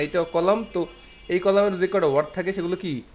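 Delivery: ordinary talk, nothing marked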